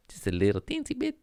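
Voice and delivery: high, quick, tiny little voice; teensy voice. Pretend. Comic admitting petty theft, high teensy voice. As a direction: squeak it out like it's nothing. Mock cute minimizing.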